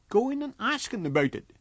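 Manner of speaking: high, urgent landlady voice. Acted. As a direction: push him on, high and urgent. Wife urges husband.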